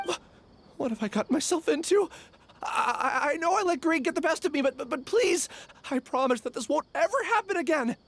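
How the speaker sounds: stammering, fast, panicky plea; nervous pleading. Acted. Caught thief begs the Acting Grand Sage, stammering in panic. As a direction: beg for mercy, tripping over the words.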